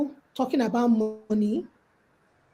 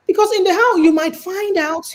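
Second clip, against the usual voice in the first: ordinary delivery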